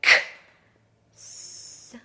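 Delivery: "K", a pause, then a hissed "S"; whispered-out "K", then "S", slowly apart; two separate sounds. Shown as a demonstration. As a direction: split X into voiceless "K" and long "S". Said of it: "It's kind of like two sounds in one letter".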